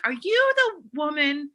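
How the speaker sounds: a fan confronting her after a show; mock stalker fan. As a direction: play an eager fan at a show. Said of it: "they're like".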